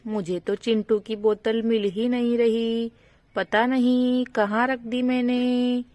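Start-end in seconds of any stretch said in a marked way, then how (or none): none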